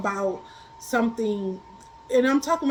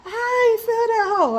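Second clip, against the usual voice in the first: laughing; 9 semitones higher, 4 dB louder than usual. Real, not acted. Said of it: "I started laughing".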